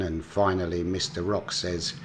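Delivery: ordinary talk, nothing marked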